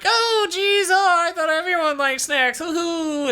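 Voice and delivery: very high, flustered cartoon squeak; squeaky King Kai. King Kai rebuffed by Beerus, very high flustered squeak. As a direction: fret in a tiny high voice with a nervous hoo-hoo. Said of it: "he's like".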